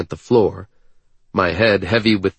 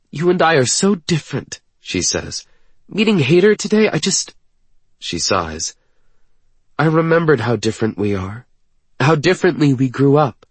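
high, agitated woman's voice; mildly upset, struggling to explain; afraid and angry; 7 semitones higher than usual. A narrator's reading. Woman explaining how different they are, afraid and frustrated. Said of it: "She's afraid. She's angry".

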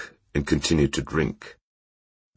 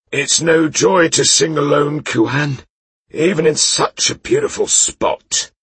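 put-on high, loud merry drunk knight; a cheerful drunk calling his horse; tipsy knight voice; 13 semitones higher, 5 dB louder than usual. Drunk knight sings alone.

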